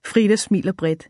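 ordinary delivery, nothing marked

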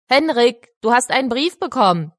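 calling out. Acted. Heimleiterin ruft Henrik herbei.

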